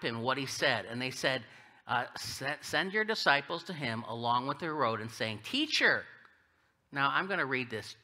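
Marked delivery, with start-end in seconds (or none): 5.4-6.0 high, drawn-out "Teacher"; butter him up with a higher, put-on greeting; falsely reverent address; insincere flattery. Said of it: "Do you think the Herodians really mean this?".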